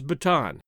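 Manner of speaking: Bataan said "buh-TAHN"; chosen pronunciation. Shown as a demonstration. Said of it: "You'll notice I pronounce".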